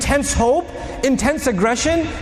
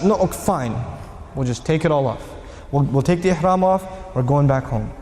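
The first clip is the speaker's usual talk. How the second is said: resigned; 8 semitones lower than usual. Retold or imitated. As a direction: grudgingly accept defeat. Crowd gives in resentfully.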